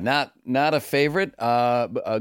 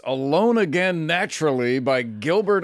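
Shadow Stevens; 5 semitones higher than usual. Acted. Guest counts down his top five songs, announcer impression. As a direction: stretch it like a radio chart host. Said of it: "my Shadow Stevens voice".

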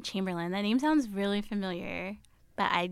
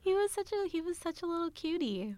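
cooing; 10 semitones higher, 4 dB quieter than usual. Real, not acted. Gushing over baby [name].

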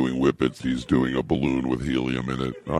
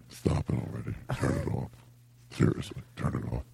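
whispering; 8 dB quieter, faster than usual. Real, not acted. Begging the others to stop.

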